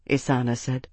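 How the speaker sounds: plain reading voice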